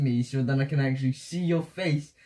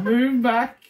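louder, higher, firm command; insistent order; 5 semitones higher, 6 dB louder than usual. Real, not acted. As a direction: push the guesser away with your voice. Repeats order over guesser's protests.

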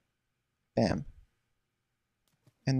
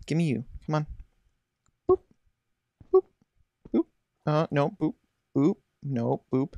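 normal talking voice

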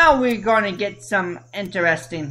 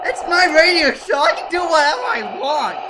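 1 defiant; 6 semitones higher than usual. Pretend. Defiant host claim.

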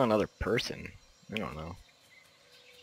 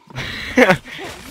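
high, voiceless laugh; short burst of laughter; 8 semitones higher, 3 dB louder than usual. Real, not acted. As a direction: let a quick laugh burst out. Laughs at the creature's name.

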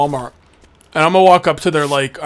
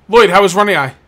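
loud call; 4 semitones higher, 9 dB louder than usual. Real, not acted. Greeting a customer in game.

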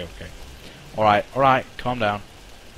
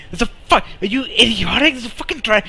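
startled, higher squeal at the screen; excited high yelp; 11 semitones higher, 4 dB louder, faster than usual. Real, not acted. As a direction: yelp out in surprise. Sudden threat in game.

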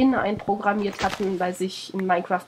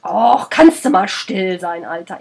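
annoyed; 3 semitones lower, 6 dB louder, slower than usual. Acted. Scolding the grunting bear.

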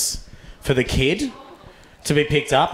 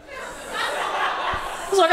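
laughing; 4 dB quieter, much slower than usual. Real, not acted.

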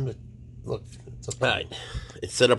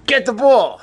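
excited urging; 12 dB louder than usual. Real, not acted. Urging pet, escalating.

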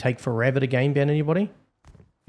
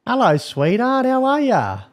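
high, drawn-out singsong hello; mock-sweet greeting; 10 semitones higher than usual. Real, not acted. Admin greeting the player he banned, mock-sweet. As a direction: croon it high and slow to taunt.

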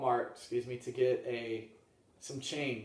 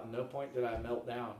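ordinary delivery

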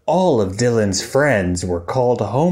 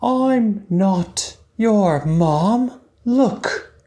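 slow, high, patient pelican; 5 semitones higher, slower than usual. Acted. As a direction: explain kindly and slowly. Story voice for the pelican.

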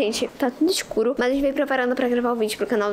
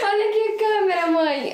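laughing; 6 semitones higher, slower than usual. Real, not acted. Laughing after prank.

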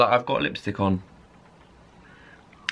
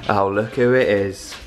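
usual speaking voice

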